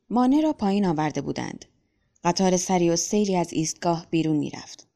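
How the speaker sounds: usual speaking voice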